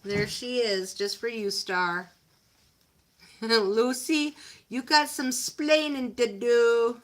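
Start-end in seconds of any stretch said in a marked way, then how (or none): 3.4-7.0 loud, higher-pitched TV husband impression; Ricky Ricardo. Owner mock-scolds her dog Lucy, loud sitcom husband impression. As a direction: call out an exaggerated accusing catchphrase. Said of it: "In my best Ricky Ricardo voice".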